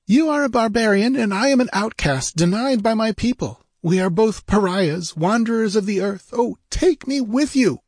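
high, fervent heroine voice; passionate plea. A narrator's reading. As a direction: plead to be taken along. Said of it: "she answered passionately".

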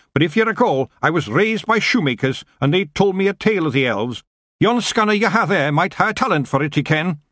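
high rough Scottish pixie; broad Scottish wee man; Scottish accent. Acted. Feagle speaks in Scots.

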